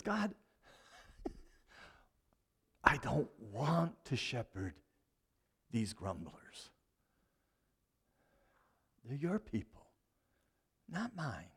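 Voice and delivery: tired, quiet, slowing, fading to near nothing; a worn-out shepherd begging to be done; weary Moses. Retold or imitated. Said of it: "Moses gets upset".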